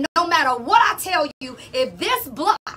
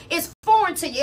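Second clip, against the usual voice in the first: ordinary talk, nothing marked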